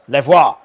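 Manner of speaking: "le voir" loud, in mock French; French phrase. Shown as a demonstration. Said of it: "So French is".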